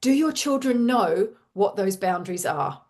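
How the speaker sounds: ordinary talk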